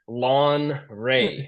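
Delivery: "Lawn Ray" drawn out as two separate words; slow "Lawn Ray" with an open AW; mispronounced name. Retold or imitated. Host recalling their mistake, slow, separated "Lawn Ray". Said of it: "we kept calling you".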